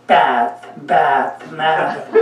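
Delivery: bath with the flat American a, like bat; American accent bath. Retold or imitated. Swami mocking American bath, American accent. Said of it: "doesn't sound good".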